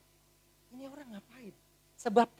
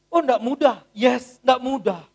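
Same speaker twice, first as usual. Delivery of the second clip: loud, slow hammered repetition; emphatic; 3 semitones higher, 11 dB louder, slower than usual. Real, not acted. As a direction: press nggak hard. Emphatic repeated exclamation.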